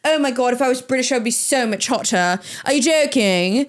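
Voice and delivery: put-on playful British accent boast. Imagining herself British and hotter, put-on British accent. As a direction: brag in a mock British accent. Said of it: "British goes very well with this aesthetic".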